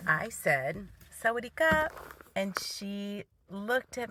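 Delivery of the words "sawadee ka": high-pitched re-enacted Thai greeting; acted-out hello, pitched up; friendly greeting. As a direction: lift the pitch for a polite little hello. Storyteller re-enacts greeting elderly Thai woman.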